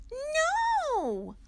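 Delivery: very high, loud squeak. Acted. Little Owl squeaks at Mommy about a penguin, very high. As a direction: yelp the no like a startled chick. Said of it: "Little Owl squeaked".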